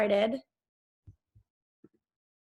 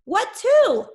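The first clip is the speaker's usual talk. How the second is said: much higher, louder puzzled question; baffled squeak; 12 semitones higher, 10 dB louder than usual. A narrator's reading. Benjamin confused by Charlie listening to nothing, high baffled blurt. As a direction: blurt it out bewildered.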